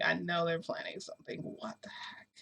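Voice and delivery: hushed suspicious whisper. Retold or imitated. Whispered suspicious inner thought.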